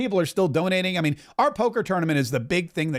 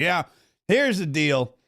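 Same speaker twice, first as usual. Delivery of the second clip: Tim Burchett impression; slower than usual. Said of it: "Tim Burchett is like".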